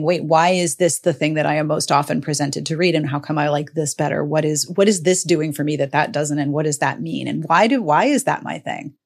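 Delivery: ordinary delivery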